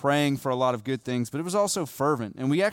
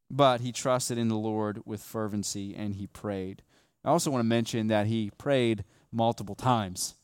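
ordinary talk

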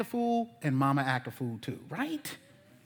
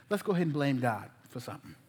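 ordinary talk, nothing marked

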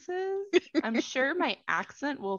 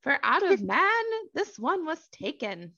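higher-pitched reading voice for the man; 8 semitones higher, 4 dB louder than usual. Acted. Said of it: "higher pitched than your default reading voice".

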